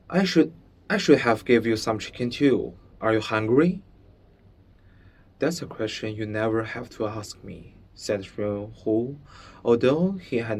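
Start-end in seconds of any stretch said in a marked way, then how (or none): 0.1-3.8 fast, hurried offer; quickly. Acted. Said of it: "he said quickly". 5.4-7.7 quick, softer dry sarcasm; sarcastic. Acted. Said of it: "knew something about sarcasm too".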